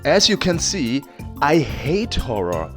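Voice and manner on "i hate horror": normal talking voice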